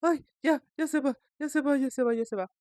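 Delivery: high frightened repeating; scared man's voice. Retold or imitated. Caller telling the host it is leaving, high scared repeating. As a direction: chant it to reassure yourself, scared. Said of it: "un hombre cuando ya está un poco asustado empiezan a hacer esta voz".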